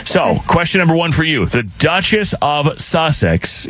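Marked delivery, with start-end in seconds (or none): 1.4-3.6 DUCHESS OF SUSSEX drawn out slowly, word by word; slow, careful "Duchess of Sussex". Real, not acted. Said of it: "you have to say it slowly".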